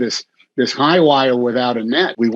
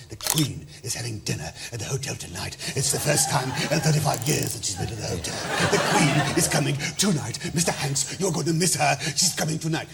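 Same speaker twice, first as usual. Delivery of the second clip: hushed, hurried mock English hotel staff accent; bad English accent; 8 dB quieter than usual. Telling the host about the Queen's visit, in a bad English accent. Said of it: "three bad accents in a row".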